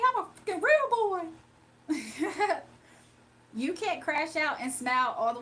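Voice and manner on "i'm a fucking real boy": high, loud mock whine; angry squeak of protest; mock Dimitri swearing he's a real boy; Dimitri impression. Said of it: "Dimitri line".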